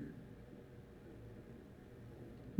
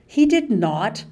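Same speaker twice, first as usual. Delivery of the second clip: protest; 4 semitones higher, 5 dB louder than usual. Acted. March objects to Mandy Sue.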